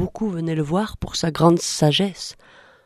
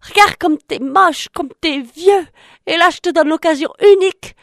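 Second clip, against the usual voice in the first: much higher, louder, scolding then begging; exasperated frog; 9 semitones higher, 5 dB louder than usual. Acted. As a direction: scold him, then plead almost in tears. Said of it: "la grenouille lui dit".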